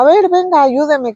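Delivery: high, panicky call for help; urgent plea for help. Retold or imitated. Painter calls to the mother for help, high and panicky. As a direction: beg her to come help, voice jumping up.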